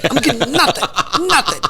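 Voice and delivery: laughing through the complaint. Real, not acted. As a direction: chuckle at the brand snubbing you. Joking about brand snubbing him.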